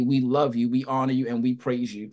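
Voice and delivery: earnest spoken prayer to God. Acted. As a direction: pray it out with reverence. Continues leading the prayer.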